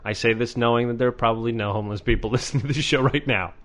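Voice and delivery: ordinary delivery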